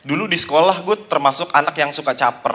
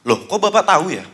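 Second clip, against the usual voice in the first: mock puzzlement; 6 semitones higher, 4 dB louder than usual. Principal acting puzzled.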